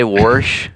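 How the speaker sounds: "Warsh" said with an r, warsh for wash; "Warsh", the Missouri way, with an r before the sh. Shown as a demonstration. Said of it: "Missouri comes right out".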